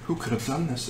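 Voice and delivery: hushed mock film-hero line; quiet, light impression of a Jedi; Obi-Wan. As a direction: softly play at grave disbelief.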